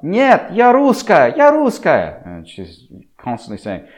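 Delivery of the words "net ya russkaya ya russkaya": put-on very high, louder child insisting; annoyed little girl. Girl annoyed at her mother, shrill high insisting. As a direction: stamp it out like a cross little girl. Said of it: "she gets quite annoyed".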